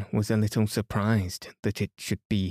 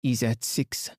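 put-on French-accented detective, declamatory; Poirot. Poirot declaring the suspect's alibi to everyone, formally accented.

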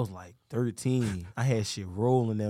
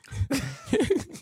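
high laugh. Real, not acted. Laugh about water ice.

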